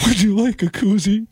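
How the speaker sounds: high, weepy offer through fake tears; mock crying. Crying bartender offers customer a koozie, tearful. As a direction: imitate someone sobbing while trying to serve. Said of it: "she starts crying".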